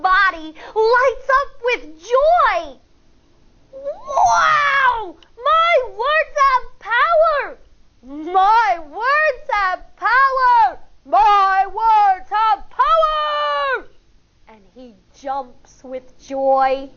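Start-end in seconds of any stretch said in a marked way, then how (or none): 3.7-7.6 much higher, thrilled cry; excited. Acted. Firefly amazed his words worked, thrilled cry. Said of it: "shocked and excited". 8.0-13.9 slow, drawn-out triumphant cry. Acted. Firefly celebrating his power, slow triumphant shout.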